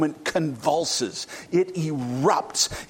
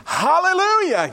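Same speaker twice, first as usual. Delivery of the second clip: loud cry; 13 semitones higher, 6 dB louder than usual. Acted. Multitude crying hallelujah.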